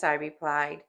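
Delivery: ordinary delivery, nothing marked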